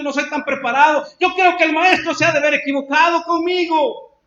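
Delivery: building from plain to a high, loud cry of self-doubt; Pedro's rising despair. Retold or imitated. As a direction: pour out Pedro's frustration until it bursts. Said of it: "Cualquiera hubiera pensado".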